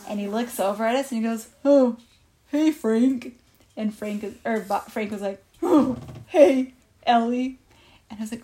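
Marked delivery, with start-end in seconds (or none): 1.6-3.4 lightly voiced elephant greeting; elephant character. Elephant greets Frank in story. 5.6-7.6 louder, lightly put-on animal voice; animal character. Frank answers the elephant.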